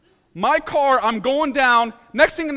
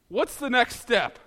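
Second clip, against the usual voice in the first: usual speaking voice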